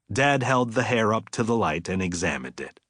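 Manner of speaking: usual speaking voice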